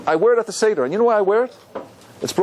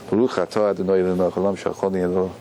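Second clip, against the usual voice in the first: low, monotone recited blessing; Hebrew blessing; 9 semitones lower than usual. Real, not acted. Rabbi pauses his lesson to say a blessing, low recitation. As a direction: recite it low on nearly one note.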